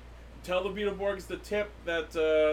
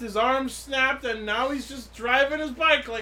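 incredulous rant; 6 semitones higher, 4 dB louder than usual. Real, not acted. Podcaster listing a character's ignored injuries, incredulous. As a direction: lay out each point, pitched up and baffled. Ranting about character's impossible recovery.

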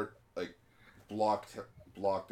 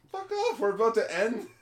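high, loud dismissal; mock annoyed yell at a latecomer; irritated outburst; 14 semitones higher, 7 dB louder than usual. D and D group rejecting a late character, irritated outburst.

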